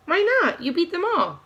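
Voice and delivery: high, puzzled "why not?"; surprised encouragement. A narrator's reading. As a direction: coax her, taken aback. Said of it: "Catherine encourages".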